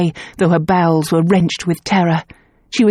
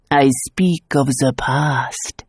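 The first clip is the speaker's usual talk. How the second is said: slow, lower, portentous; ominous seer; 4 semitones lower, much slower than usual. Acted. Clairvoyant revealing the past, slow and ominous. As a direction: let the words sink like a prophecy.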